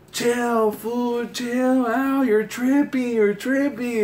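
high-pitched imitation of protesting friends; mock homies calling out "chill"; pleading to chill. Homies calming down a yelling dad.

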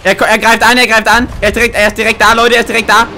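normal talking voice

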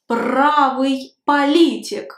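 ordinary talk, nothing marked

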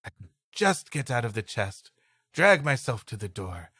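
ordinary talk

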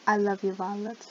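ordinary talk

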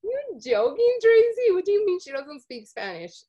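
high, loud disbelieving question; incredulous. Retold or imitated. Host retelling disbelief to Tracy. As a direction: challenge her in mock disbelief. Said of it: "I was like".

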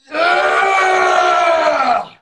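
long drawn-out yell; cheering yell. Real, not acted. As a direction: whoop it up loud. Cheering during the dance battle.

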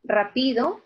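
"rápido" said as ra-PI-do; stress on middle syllable. Shown as a demonstration. Teacher offering a second option for "rápido", middle-syllable stress. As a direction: wrongly put the weight of "rápido" on PI. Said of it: "Yo digo".

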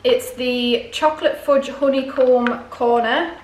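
ordinary delivery

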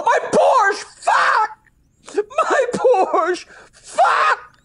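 high-pitched wailing cry of dismay; hammed-up howl over a loss; anguished wail. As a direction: wail it like a distraught lawyer. Distraught lawyer in acted joke.

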